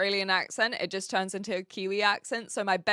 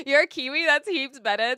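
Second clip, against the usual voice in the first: laughing; 5 semitones higher, 6 dB louder than usual. Real, not acted. Laughing reply to a Kiwi viewer.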